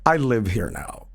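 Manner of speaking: low menacing finality; low smug intruder. Retold or imitated. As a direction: deliver the verdict coolly. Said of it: "And he's like".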